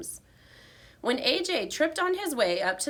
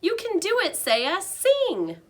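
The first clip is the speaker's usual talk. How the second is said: high, louder squeaky urging; squeaking loudly; 11 semitones higher, 5 dB louder than usual. A narrator's reading. Hamster cheering the shy girl on from his cage. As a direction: squeak it out as loud as you can. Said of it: "I squeaked out as loudly as I could".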